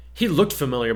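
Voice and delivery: ordinary delivery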